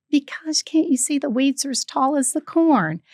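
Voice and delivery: high-pitched retold exasperation; exasperated retort. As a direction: imitate a fed-up comeback. Retold annoyed comeback to a teaser.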